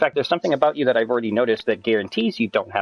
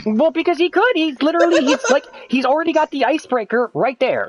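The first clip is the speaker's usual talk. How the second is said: high-pitched, louder excited arguing; worked-up insistence; 4 dB louder than usual. Real, not acted. Friend defending his theory about the British accent, worked-up. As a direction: argue your point at a high pitch.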